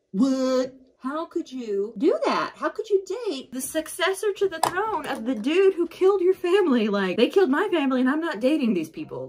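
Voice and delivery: high, shocked outrage at a betrayal; outraged friend. Retold or imitated. Friend scolding Nemesis for dating the enemy, high outraged. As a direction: squeal it out, appalled and disgusted. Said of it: "she's like".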